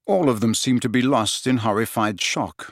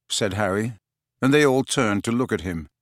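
ordinary delivery

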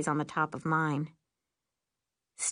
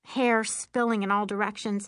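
plain reading voice

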